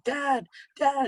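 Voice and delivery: high-pitched, plaintive call to a father; imitated sobbing son. Son bursts out of his room to dad, imitated tearful pleading. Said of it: "he's sobbing, and he's, he's going".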